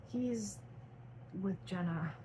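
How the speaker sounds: soft sad reply; a touch of sorrow, dropping soft; said sadly. Acted. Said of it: "Tyler said sadly".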